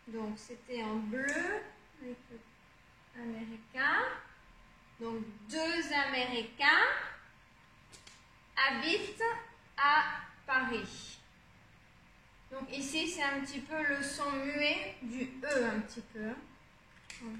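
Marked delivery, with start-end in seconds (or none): 5.5-11.2 "deux Américains... habitent... à... Paris" with long pauses; word by word. Shown as a demonstration. Teacher sounding out sentence word by word.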